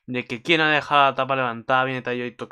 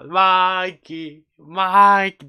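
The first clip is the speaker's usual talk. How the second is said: higher, louder chanting the name; excited chant; fan cheer; 7 semitones higher, 9 dB louder, much slower than usual. Real, not acted. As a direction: hype cheering. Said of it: "Y ahí dije ya".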